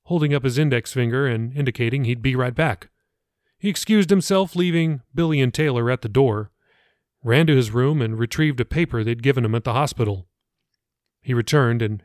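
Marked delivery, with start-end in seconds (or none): none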